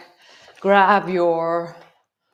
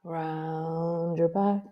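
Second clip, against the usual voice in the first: slow, softer yoga instruction; long, soft, unhurried cue; drawn-out movement cue; 7 dB quieter, much slower than usual. Real, not acted. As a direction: stretch the first word gently to pace the movement.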